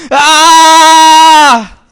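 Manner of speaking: long high loud scream of the damned; anguished scream. Retold or imitated. Preacher imitates the damned screaming together, long loud scream. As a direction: scream like millions in torment. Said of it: "millones de almas gritando".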